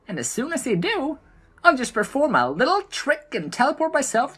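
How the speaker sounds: a mischievous little gnome teasing from above; gleeful gnome. A narrator's reading. The gnome taunts the blood trolls, high and impish. Gnome gloating before teleporting.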